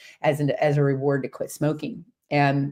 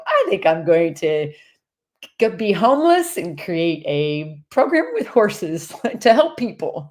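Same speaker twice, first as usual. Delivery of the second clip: rising, louder, slowly with laughter; announcing a wild plan; 3 semitones higher, 4 dB louder than usual. Retold or imitated. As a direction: declare the crazy plan half laughing. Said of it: "for me to go".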